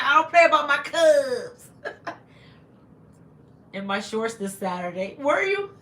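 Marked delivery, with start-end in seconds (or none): 0.0-3.7 laughing. Real, not acted. Laughing at a viewer's joke.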